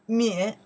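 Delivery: 敏 said loudly as a model; model pronunciation. Shown as a demonstration. Teacher pronounces the parts together for learners, loud 敏. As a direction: project 敏 for learners to copy. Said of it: "we pronounce the".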